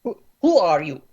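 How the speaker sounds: put-on brusque Slavic-accented questioning. Scowling man questions captive, brusque Slavic accent. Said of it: "That's pretty good Slavic ac-, accent".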